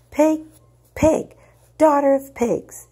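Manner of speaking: put-on high, loud childish screech of rage; shrieked insult. Spoiled girl screaming insults at absent ayah, enraged. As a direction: spit out the insults like a furious child. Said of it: "grinding her teeth and saying this".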